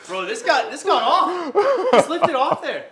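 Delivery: high, loud, laughing outburst; excited disbelief. Real, not acted. Coach stunned that the bar lifted off, excited shouting. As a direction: yell it, amazed and half laughing.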